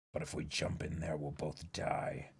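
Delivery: a deep, hushed voice weighing a desperate plan; low, grave character voice. Acted. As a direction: speak low and grim about a hopeless mission.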